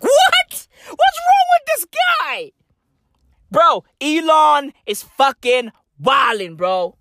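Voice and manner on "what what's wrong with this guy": high, squeaky incredulous voice. Real, not acted. Comic baffled by Elon's apology, high squeaky disbelief.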